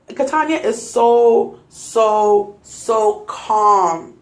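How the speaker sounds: normal talking voice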